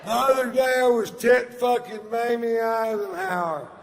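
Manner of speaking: high, loud, booming stage delivery; stand-up shouting. Retold or imitated. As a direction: bellow the old bit to the crowd. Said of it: "dust her off and take her for a spin".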